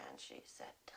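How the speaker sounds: hushed whisper telling a secret; whispered aside about the nice lady. Retold or imitated. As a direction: lean in and whisper it. Whispered retelling.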